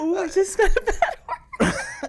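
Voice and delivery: put-on high, laughing childish tease; mock guilty, laughing. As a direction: pretend to be a naughty kid, giggling. Mock guilt over cursing.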